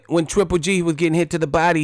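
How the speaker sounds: normal talking voice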